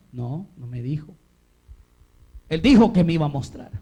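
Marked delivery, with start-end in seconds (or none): 0.1-1.1 low, understated answer; lightly acted Abraham. Abraham answering his wife's questions, lightly acted and low. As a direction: drop the pitch a little for the husband's reply. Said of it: "me imagino que su esposa le dijo".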